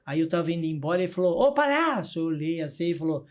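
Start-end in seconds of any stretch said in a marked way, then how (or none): 1.4-2.2 higher call across the corridor; half-shouted call to the clown. Retold or imitated. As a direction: hail him from behind. Patient calls after the clown.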